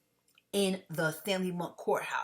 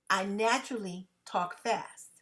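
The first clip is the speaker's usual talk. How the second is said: ordinary talk, nothing marked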